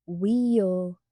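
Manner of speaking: "wheel" said with a long ee vowel for Will; mock "wheel" in place of Will; mocking accent. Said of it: "He pronounced Will "wheel," making fun of Will's accent".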